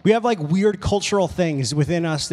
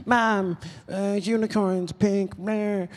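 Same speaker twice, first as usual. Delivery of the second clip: put-on annoying little girl; 5 dB quieter, much slower than usual. Daughter pestering her mom in a store, imitated annoying kid voice. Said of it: "her daughter was just being so annoying".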